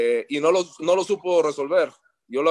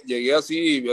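ordinary talk

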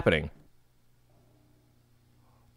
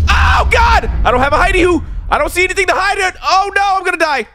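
scared yelling; 12 semitones higher than usual. Real, not acted. Cornered player with no hiding spot, frantic scared yelling. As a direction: yell in real panic, nowhere to hide. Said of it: "I tend to yell when I'm scared".

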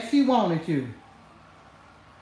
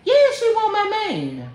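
ordinary delivery

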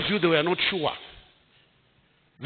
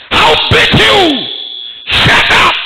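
loud, high, slow furious yell; hammed-up threatening shout at a child; angry threat; 11 semitones higher, 12 dB louder, slower than usual. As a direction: bark it like a fed-up uncle. Uncle threatening crying niece.

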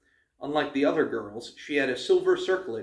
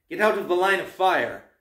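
higher, louder urgent order; shouted warning; 5 semitones higher, 5 dB louder than usual. A narrator's reading. Archer orders Annabeth out of the way, shouting. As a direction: call out a warning. Archer warns girl in danger.

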